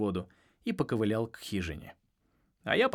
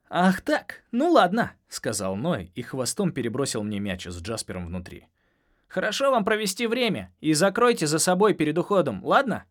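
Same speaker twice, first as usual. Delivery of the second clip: teasing; 5 semitones higher, 5 dB louder than usual. Acted. Dolphin teasing Jasper.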